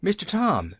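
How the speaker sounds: high wailing call to Tom; wailing Nancy. A narrator's reading. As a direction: cry out his name in distress. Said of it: "she wailed".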